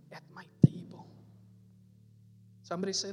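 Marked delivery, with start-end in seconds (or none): 0.1-1.1 whispered, breathed out like a secret. Acted. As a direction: drop to a hushed whisper on the promise. Repeating the promise softly.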